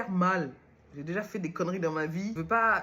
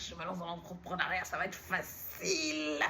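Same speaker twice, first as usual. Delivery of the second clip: naive past self; 6 dB quieter than usual. Retold or imitated. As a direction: imitate a beginner blissfully sure it's easy. Imitating his naive past self.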